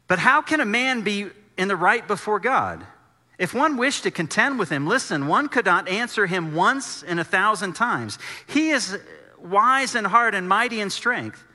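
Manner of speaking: scripture reading at full force. Acted. Preacher reading Job aloud to the congregation, forceful. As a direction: proclaim Job's words with weight.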